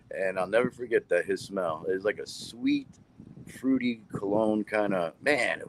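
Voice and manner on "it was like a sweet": ordinary talk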